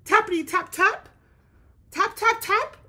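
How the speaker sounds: high, loud tap tap; tapping sound. A narrator's reading. Teacher to class, voicing the woodpecker's tapping loudly. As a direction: pop each tap up high.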